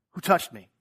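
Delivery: Jesus demanding who touched him; Jesus asking. Acted. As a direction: call out over the crowd. Jesus voiced asking in crowd.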